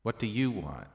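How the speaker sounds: "you" punched, then falling away; emphatic "you" in the question; stress on you. Shown as a demonstration. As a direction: lean on the word "you". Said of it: "indicated by the stress of the voice".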